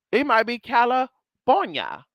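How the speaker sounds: "California" said with a B, slowly; California as Calabornia. Shown as a demonstration. Vlogger to viewers, musing on names: slow Calabornia. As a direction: say "California" like kah-luh-BOHR-nyuh. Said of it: "You say the F so fast".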